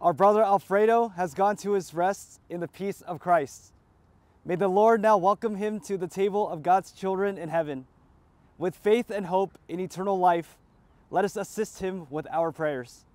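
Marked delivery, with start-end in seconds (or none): none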